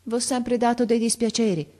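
trembling, halting voice. A narrator's reading. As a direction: confess with effort, close to tears. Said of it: "a stento, con la voce tremola". (voce tremola, a stento)

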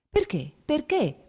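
rising, urgent why; alarmed questioning. Acted. Startled husband presses wife to explain why, alarmed. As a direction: demand the reason, startled.

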